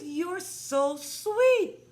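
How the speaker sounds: put-on very high, loud, stretched-out baby talk; gushing coo. Repeating praise to win over a pouting girl, gushing coo. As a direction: gush over a toddler, drawing it right out.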